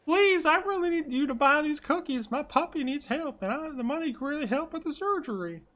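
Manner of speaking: very high falsetto begging; high-pitched hard-luck sales plea; pleading cookie seller. Acted.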